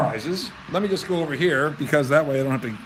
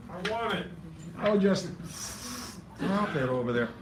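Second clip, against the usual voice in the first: ordinary talk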